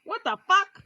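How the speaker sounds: high, indignant mock outrage; exasperated complaint. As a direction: complain as if robbed of your prize. Thwarted woman complains in story.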